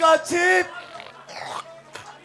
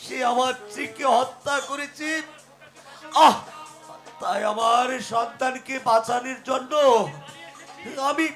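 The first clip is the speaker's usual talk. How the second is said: dying, weak voice. Acted. Dying man entrusts his child.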